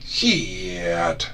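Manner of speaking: stretched Southern "Shit" in a light grandma voice; nanny's drawn-out cuss. Retold or imitated. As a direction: drag "Shit" out slowly like nanny. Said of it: "she'd be like".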